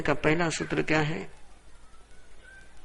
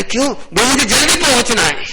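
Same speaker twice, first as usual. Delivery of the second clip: loud, high-pitched acted urgency; urgent hurry; 8 semitones higher, 7 dB louder than usual. Man running inside a train explains himself, loud hurried cry. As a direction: play a passenger in a frantic rush.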